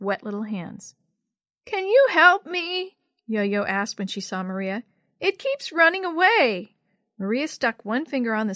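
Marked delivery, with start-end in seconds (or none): 1.6-3.0 put-on high, loud, slow child voice; pleading little sister. A narrator's reading. Little sister struggling with slippery soap. 5.2-6.8 put-on high, loud little-girl voice; frustrated little sister. A narrator's reading. Little sister struggling with slippery soap.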